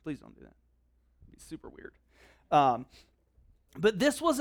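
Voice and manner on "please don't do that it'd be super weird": hushed joke. Real, not acted. Joking aside to congregation.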